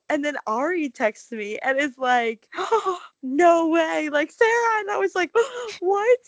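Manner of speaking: gasping, pitch shooting up as the story builds; excited retelling. Retold or imitated. Excitedly retelling the Harry news.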